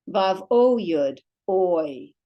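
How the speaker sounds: ordinary talk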